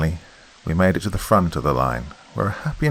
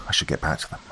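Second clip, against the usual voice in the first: nervous whisper; 3 semitones lower, 7 dB quieter, faster than usual. A narrator's reading. Nervously leaving the stranger.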